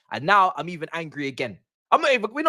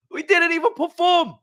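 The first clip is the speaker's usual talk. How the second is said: exasperated outburst; 10 semitones higher, 4 dB louder than usual. Real, not acted. Fan repeats it to viewers, now higher and exasperated. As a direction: pitch jumps much higher on perform. Fan ranting about team's loss.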